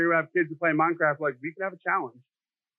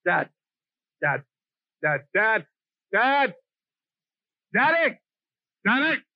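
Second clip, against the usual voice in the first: son calling dad; slower than usual. Retold or imitated. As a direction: pester dad like an impatient kid. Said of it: "what my son does".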